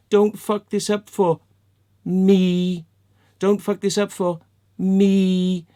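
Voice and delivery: "me" set apart after a pause, loud and held; stress on me. Retold or imitated. Quoting Dean's selfish text back at him, loud, drawn-out stress on "me". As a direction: lean hard and long on the word "me".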